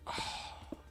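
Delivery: hushed, breathy ad whisper mocking the chocolate; sarcastic imitation of a whispered commercial. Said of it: "We're gonna whisper it's so good".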